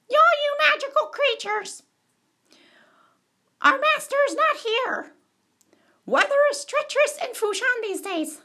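very high-pitched announcement; put-on character voice. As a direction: call it out in a comic high voice. Messenger addresses the town crowd.

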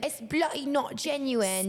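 slow mock British accent with bloody; hammed-up British accent, stretched out. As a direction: play a cross Brit, slowly. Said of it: "A British accent".

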